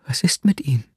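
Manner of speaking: unvoiced, breathy whispered question; hushed whisper of a worried woman. A narrator's reading. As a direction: breathe the words out with no voice. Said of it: "Fragte sie schließlich".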